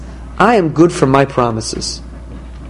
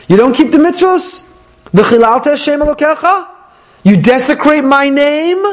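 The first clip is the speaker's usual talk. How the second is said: stern warning God; 10 semitones higher than usual. Acted.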